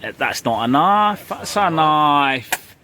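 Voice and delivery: hammed-up Australian movie quote, stretching the last knife; bad Australian accent. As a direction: do a clumsy Australian Crocodile Dundee. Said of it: "my bad Australian accent".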